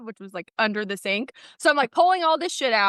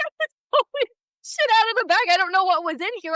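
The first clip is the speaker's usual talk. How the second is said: high, fast retold rant; exasperated; 8 semitones higher, faster than usual. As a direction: vent at him in disbelief. Retold yelling at husband.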